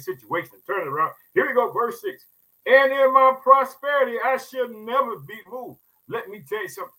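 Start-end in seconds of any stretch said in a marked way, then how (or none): 2.7-5.8 scripture reading. Acted.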